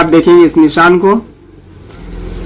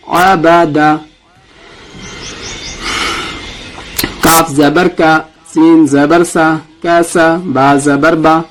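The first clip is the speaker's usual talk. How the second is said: slow, deliberate letter-by-letter spelling; slow recitation drill; slower than usual. Shown as a demonstration. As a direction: spell each letter for students to repeat. Teacher leads practice drill. (آہستہ قاعدہ پڑھانا)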